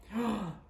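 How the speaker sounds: sharp intake of breath in delight; short excited gasp; thrilled gasp. Acted. Gamble hears about the festival, gasping with excitement.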